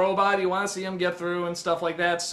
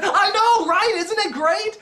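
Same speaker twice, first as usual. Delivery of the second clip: much higher, louder gushing agreement; delighted fans; 12 semitones higher, 4 dB louder, slower than usual. Retold or imitated. Cheering audience agreeing with the reviewer, high gushing. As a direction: agree eagerly like a thrilled fan. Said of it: "just said".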